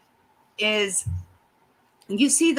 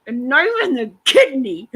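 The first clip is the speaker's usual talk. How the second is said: laughing, louder mock quote of a dramatic man; mocking his drama; 3 semitones higher, 6 dB louder than usual. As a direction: scoff at his overacting through a laugh. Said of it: "he's so dramatic too".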